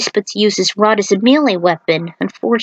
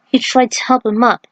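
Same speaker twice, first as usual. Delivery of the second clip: ordinary delivery, nothing marked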